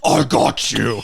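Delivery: low mock tough-guy voice; hammed-up low tough-bird voice; beefcake bird. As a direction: put on a low, swaggering muscle-bird voice.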